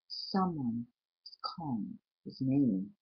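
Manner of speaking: soft, slow, suspenseful narration; hushed and slow. A narrator's reading. Narrator building dread over Connor's dark room, hushed. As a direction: drop to an eerie hush.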